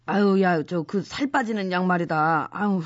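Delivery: low, nauseous groaning; queasy, sick voice. Acted. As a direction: sound sick and dizzy. Sick and dizzy from pills.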